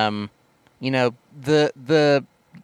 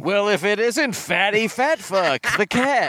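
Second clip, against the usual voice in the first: slow, higher, hammed-up character voice; mock taunting greeting; 6 semitones higher than usual. Parodying the movie's fat joke, hammed-up taunting greeting. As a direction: draw out a jeering hello, rising at the end.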